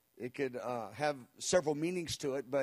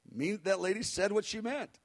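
ordinary talk, nothing marked

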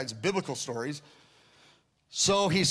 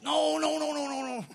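urgent refusal; 12 semitones higher than usual. Retold or imitated. Friend refusing to pray.

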